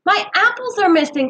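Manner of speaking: high, loud alarmed cry; upset cry about the apples; cried out. A narrator's reading. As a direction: call out in dismay over the loss. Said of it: "cried Philip".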